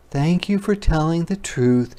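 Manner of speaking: lightly voiced kind teacher; teacher's voice. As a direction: greet the child like a friendly teacher. Teacher praises Ruthie in story.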